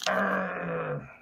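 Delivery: slurred pirate growl; drunk pirate voice. Pretend. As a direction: play a tipsy pirate. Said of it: "Sounds like a drunk pirate".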